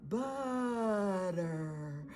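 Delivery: "Butter" drawn out long and slow; slow motion. Shown as a demonstration. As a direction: stretch "Butter" for a child to follow. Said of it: "Let's say it again together".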